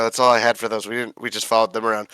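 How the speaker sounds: usual speaking voice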